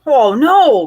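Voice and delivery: loud dismayed cry; cried out. Acted. Farmer seeing the trapped cow, loud cry. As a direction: call out in alarm. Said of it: "cried the farmer".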